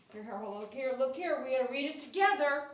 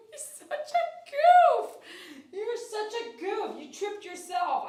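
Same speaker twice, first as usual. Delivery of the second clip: high, slow giggly teasing, much louder at first; laughing at the dog; 8 semitones higher, 9 dB louder, slower than usual. Real, not acted. Groomer laughs at the dog who tripped, high and slow. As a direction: laugh it out at the clumsy pup.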